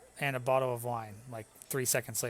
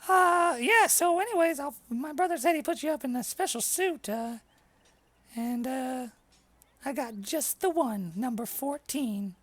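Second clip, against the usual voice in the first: high, chatty inn host voice; higher innkeeper checking on guests; friendly innkeeper; 11 semitones higher, 4 dB louder than usual. Acted.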